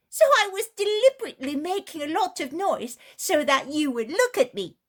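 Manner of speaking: put-on a frank child blurting out the truth; a boy blurting it all out, high and loud; child's exclaiming voice. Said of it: "immediately exclaims".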